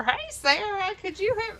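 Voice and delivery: put-on high, sweet old lady greeting; a hint of a frail grandma saying hi; lightly voiced grandma. Grandma mistaking the visitor's name, lightly voiced old lady.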